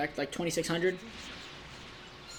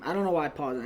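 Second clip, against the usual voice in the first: normal talking voice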